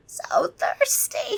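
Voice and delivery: high breathy vampire whisper, slow; vampire murmurs his thirst at a girl's throat; hungry murmur. Acted. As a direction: breathe it out, craving blood. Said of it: "he murmured".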